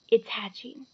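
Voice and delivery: hushed breathless whisper. A narrator's reading. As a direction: whisper the secret news. Reading Hagrid's secret note.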